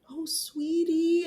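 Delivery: high, drawn-out imitated coo; cooing sympathy. Imitating nurse hugging crying patient, cooing sympathy. Retold nurse comforting her.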